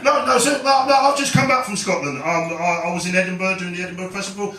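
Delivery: ordinary delivery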